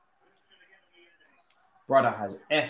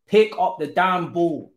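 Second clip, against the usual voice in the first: fed-up yell; 7 semitones higher, 7 dB louder than usual. Real, not acted. Repeated order to player.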